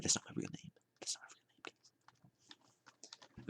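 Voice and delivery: barely audible hushed whisper; whispered aside. Pretend. Telling viewers it isn't his real name, whispered aside. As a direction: whisper it confidentially, almost inaudibly.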